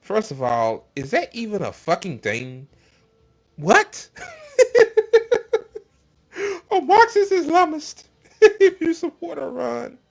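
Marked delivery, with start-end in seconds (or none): 3.6-10.0 laughing. Real, not acted. Laughing at absurd Levin tweet.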